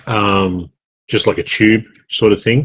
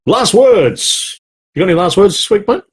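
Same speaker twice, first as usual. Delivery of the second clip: announcer voice; 10 semitones higher than usual. Real, not acted. Host announcing segment.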